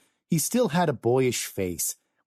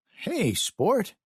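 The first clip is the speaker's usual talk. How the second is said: friendly high greeting; smiling; 12 semitones higher than usual. Acted. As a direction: smile into the greeting. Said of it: "Walter smiled".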